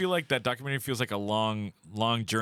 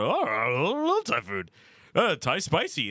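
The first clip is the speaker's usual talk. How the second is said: higher, drawn-out mock bravado; showing off; 6 semitones higher, 3 dB louder, slower than usual. Telling a date he loves Thai food, showing off. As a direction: lay it on thick to impress a date. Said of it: "trying to impress a girl on a date".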